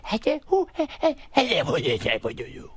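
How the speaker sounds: high, hammed-up Sandler yelps; Adam Sandler bit. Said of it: "he does the thing where it's like".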